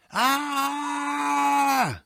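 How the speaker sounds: gequälter Aufschrei. A narrator's reading. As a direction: roar out in despair, high and drawn-out. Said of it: "brüllte Wronski und griff sich an den Kopf". (gequältes, hohes, lang gezogenes Brüllen)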